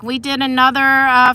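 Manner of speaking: ordinary talk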